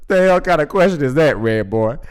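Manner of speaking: fast, loud, high disbelief; can't believe he asked that; incredulous. Real, not acted. Host reacting to Red Boy's voice-changer question, loud disbelief.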